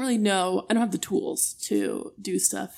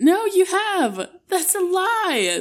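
high, loud, slow protest; mock outrage; 10 semitones higher, 6 dB louder, slower than usual. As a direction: wail it in mock disbelief.

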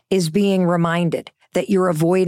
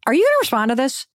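pushy question; 5 semitones higher, faster than usual. Retold or imitated. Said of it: "I called her and I said".

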